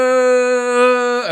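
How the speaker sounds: long, loud high hoot; mock "oh" howl. Host exaggerating the man's cry, endless high hoot. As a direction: hold one high note in exaggerated mimicry.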